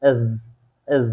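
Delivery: এজ said with a buzzing end; German letter S named slowly. Shown as a demonstration. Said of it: "ডয়েসরা এটাকে উচ্চারণ করে থাকে".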